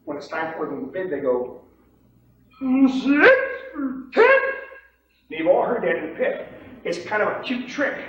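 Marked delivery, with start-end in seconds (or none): 2.6-4.4 high, slow cracking squeak; mock a nervous bid, loud and strangled; unsure beginner. New trader bidding in the pit, unsure squeal. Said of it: "they're unsure of themselves and uncomfortable".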